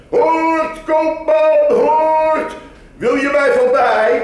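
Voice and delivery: high, ringing call to the merchant; loud market call. Acted. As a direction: hail the buyer across the market. Bird sellers accosting him. (luid roepende vogelkoopman)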